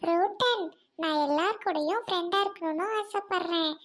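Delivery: ordinary talk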